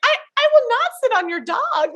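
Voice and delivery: high, strained promise; nervous earnest promise. Retold or imitated. Fan starstruck beside [name], high nervous squeaky promise. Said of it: "And I was like".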